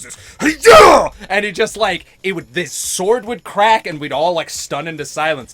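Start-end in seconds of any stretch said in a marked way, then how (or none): 0.4-1.1 mighty ki shout. Retold or imitated. Recalling the sensei's strike, a loud ki shout. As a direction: blast it out with full lungs. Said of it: "these mighty lungs".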